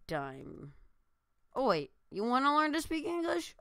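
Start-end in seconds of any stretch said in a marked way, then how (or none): 1.5-3.6 much higher, sudden surprised question; surprised realisation. Real, not acted. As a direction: suddenly realise what he means.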